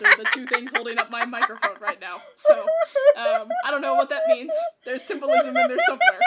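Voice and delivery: high, loud laughing through words; laughing hard. Real, not acted. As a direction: crack up while talking. Laughing fit about the mic.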